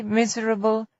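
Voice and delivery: ordinary delivery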